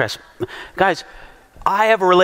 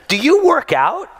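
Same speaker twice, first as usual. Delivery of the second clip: pitched-up, chatty lady voice; mimicking the lady; 8 semitones higher than usual. Retelling the woman at the gym, in a light, higher voice. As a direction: play the curious lady, higher. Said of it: "And then she says".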